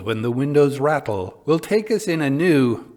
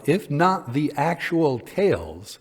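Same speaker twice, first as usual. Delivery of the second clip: usual speaking voice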